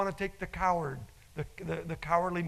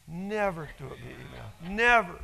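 final Never punched loud; stressed never; 5 dB louder than usual. Acted. Preacher warning the church, Never punched loud. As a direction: hit Never hard at the end.

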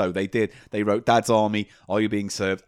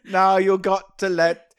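louder mock Italian voice; hammed-up Italian accent; 6 semitones higher, 5 dB louder than usual. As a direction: play a cartoon Italian pushing his luck. Mock Italian character bit.

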